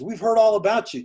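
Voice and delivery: high, loud and delighted welcome; excited greeting. Retold or imitated. Staff greeting him warmly, excitedly.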